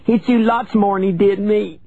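put-on very high, hurt child's retort; crying out. A narrator's reading. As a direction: throw it back as a high-pitched cry. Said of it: "she cried".

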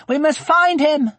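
put-on high, loud, desperate girl; high-pitched child's alarm; urgent shout. A narrator's reading. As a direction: cry out to rally a search. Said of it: "she shouted".

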